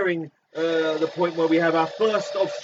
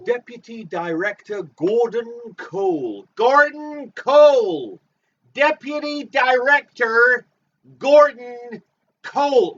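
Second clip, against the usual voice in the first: shouting; 6 semitones higher, 9 dB louder, slower than usual. Acted. Shouting the name Gordon Cole.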